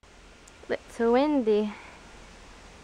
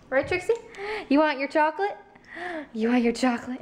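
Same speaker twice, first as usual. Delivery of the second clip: higher, sing-song talk to the dog; 5 semitones higher, 3 dB louder, faster than usual. Real, not acted. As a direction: coax the pet sweetly. Talking to the dog.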